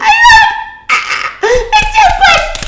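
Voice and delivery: very high, slow, gushing squeal; real delighted fan squeal. Real, not acted. Gushing over the cute scene.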